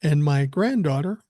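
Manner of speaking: ordinary delivery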